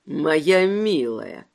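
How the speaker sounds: low, slow cooing menace; cutesy baby talk. A narrator's reading. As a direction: coo it like mock baby talk. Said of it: "просюсюкал Сантино".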